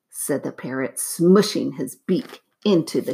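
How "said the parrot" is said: ordinary delivery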